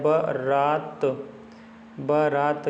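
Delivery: बारात stretched slowly, ba-raat, rising at the end; slow spelling-out. Shown as a demonstration. Teacher to students stressing the right spelling, slow. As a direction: draw बारात out for the class. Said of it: "बारात होता है".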